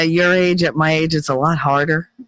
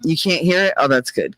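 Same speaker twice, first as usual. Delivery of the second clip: ordinary delivery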